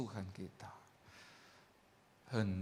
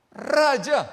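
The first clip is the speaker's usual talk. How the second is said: Raja shouted high and loud; the king bellows his own title; proud shout; 13 semitones higher, 13 dB louder than usual. Acted. David boasts of his rank.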